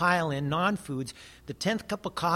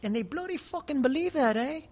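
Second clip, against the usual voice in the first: much higher, put-on Kiwi bloke voice; imitating New Zealander; 11 semitones higher than usual. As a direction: mimic an astonished local, pitched way up. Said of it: "people were like".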